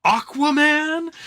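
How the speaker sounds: high, loud, slow shout; bursting-in shout. Retold or imitated. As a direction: yell it like you broke through a wall. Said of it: "bursts through a wall".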